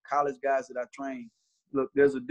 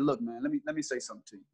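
much softer, calm warning; 4 semitones lower, 7 dB quieter, faster than usual. Retold or imitated. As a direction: settle down and speak low to him. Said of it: "And I was very calm".

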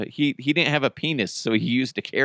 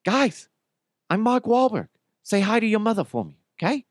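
much higher, fast goofy Wahlberg voice; high put-on Wahlberg with a hint of Boston; Mark Wahlberg; 8 semitones higher than usual. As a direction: squeak it out as Wahlberg greeting. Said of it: "he always is like".

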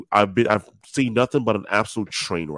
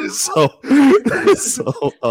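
laughing through the words; trying hard not to crack up; stifled giggles; 10 dB louder, slower than usual. Real, not acted. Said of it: "I'm trying not to laugh".

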